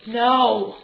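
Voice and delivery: loud correction. Real, not acted. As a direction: correct him firmly but kindly. Correcting child loudly.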